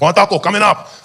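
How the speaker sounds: high, loud order call; taco vendor. Acted. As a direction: sing out the order. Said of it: "He's like".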